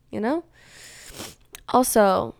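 ordinary delivery

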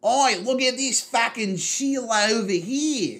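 loud mock Australian bloke calling out; Australian accent. Friend keeps mocking Kano, loud hammed-up Australian accent. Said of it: "really fucking Australian".

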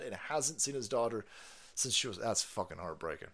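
usual speaking voice

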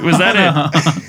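slow, drawn-out echo through laughter; laughing mock echo. Real, not acted. Teasing his friend for stopping the impression short, slow and laughing. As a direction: stretch it out, laughing at him.

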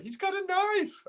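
very high, slow mock alarm; woman's warning. Retelling a Police Squad scene, high mock alarm. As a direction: squeak it way up like a panicked woman. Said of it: "that woman's like".

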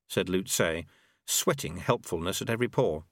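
usual speaking voice

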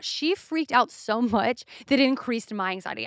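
normal talking voice

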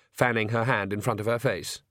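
plain reading voice

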